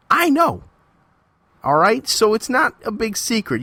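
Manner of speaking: real indignant outburst at the promoter; heated confrontation. Real, not acted. Confronting the promoter who bashed him.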